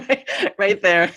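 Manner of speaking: laughing through the words; real amused laugh breaking into the words. Real, not acted. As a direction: giggle it out as you finish. Laughing at own podcast joke.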